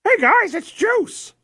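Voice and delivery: very high-pitched happy dog voice; Juice. Acted. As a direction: squeak it out like a cheerful pup. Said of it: "can I be the voice of Juice for this?".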